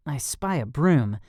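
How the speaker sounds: ordinary delivery, nothing marked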